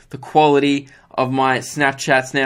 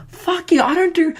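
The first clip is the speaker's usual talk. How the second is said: mock angry outburst; 13 semitones higher than usual. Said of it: "getting angry as I was answering".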